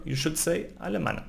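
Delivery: ordinary delivery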